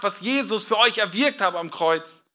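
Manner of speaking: ordinary delivery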